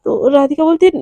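ordinary delivery, nothing marked

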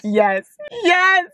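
high-pitched thrilled yeses; fangirl cheer. Real, not acted. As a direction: squeal your approval. Fangirl reaction to confession.

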